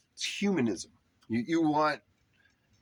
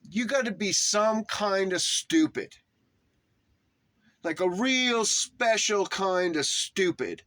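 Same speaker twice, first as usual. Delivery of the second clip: higher-pitched, heavily emphatic rant; sounding mad; 5 semitones higher than usual. Real, not acted. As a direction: berate them, leaning hard on the words. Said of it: "like I'm mad about it".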